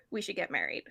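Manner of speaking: low, soft, understated suggestion; casually downplayed, quiet and low; matter-of-fact. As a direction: say it matter-of-factly, voice dropped. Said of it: "like, matter-of-factly".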